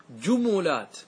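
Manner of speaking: جملات as ju-mu-lat; three syllables in جملات, "u" twice; vowel-echo plural. Shown as a demonstration. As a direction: copy the "u" onto the م in جملات. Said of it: "أن تتبع".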